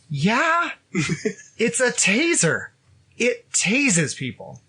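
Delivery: high, drawn-out stating of the obvious; incredulous retort. Retold or imitated. Husband answering wife about her taser, incredulous retort.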